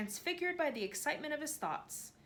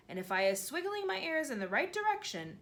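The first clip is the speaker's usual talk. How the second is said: higher, wondering giant voice; a giant marvelling at tiny things; lightly voiced awed giant; BFG voice; 7 semitones higher, 4 dB louder than usual.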